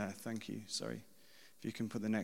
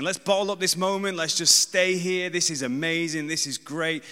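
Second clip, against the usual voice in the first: loud, higher-pitched excited gushing; acted-out excitement, raised and loud throughout; Pete; 4 semitones higher, 9 dB louder than usual. Disciple wanting to stay on the mountain, excited and loud. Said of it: "what Pete was saying".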